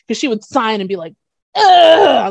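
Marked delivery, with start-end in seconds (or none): 1.5-2.3 frustrated groan. Retold or imitated. Child frustrated with signing.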